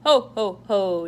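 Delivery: very loud ho ho ho; big jolly Santa laugh; Santa chuckle. Acted. Santa laughing at the snowman, loud jolly ho ho. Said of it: "chuckled Santa".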